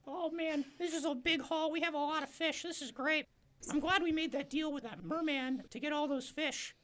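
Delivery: very high squeaky fisherman. Acted.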